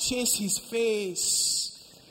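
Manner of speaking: high, loud and very slow exhortation; preaching at full force. Acted. Preacher urges the congregation, loud and slow. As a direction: drive each phrase home.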